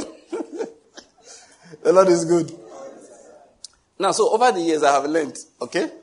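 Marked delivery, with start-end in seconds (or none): none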